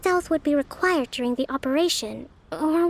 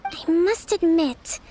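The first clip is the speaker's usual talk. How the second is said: ordinary talk, nothing marked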